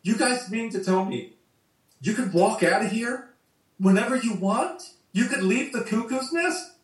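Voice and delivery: high, disbelieving outburst from a man who can't leave; rising incredulous rant, mildly acted; blowing a gasket. Patient in the asylum to the voluntary inmates, incredulous outburst. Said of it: "he just blows a gasket".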